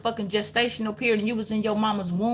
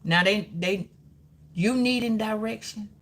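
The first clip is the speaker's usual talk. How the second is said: ordinary talk, nothing marked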